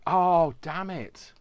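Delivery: loud, high, drawn-out exclamation; sudden dismay. Real, not acted. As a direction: cry out on remembering something forgotten. Remembers forgotten food in freezer.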